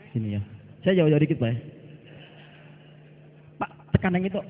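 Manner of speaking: normal talking voice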